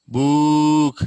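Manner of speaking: book stretched into a long booo, loud; drawn-out long oo. Shown as a demonstration. Teacher shows the wrong long vowel, loudly stretched. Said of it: "we cannot say".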